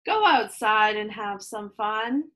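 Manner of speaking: plain reading voice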